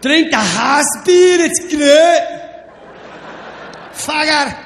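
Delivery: put-on loud drunk Scottish brogue; Scottish accent. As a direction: bark it like a drunk Glasgow barman. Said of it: "The guy goes".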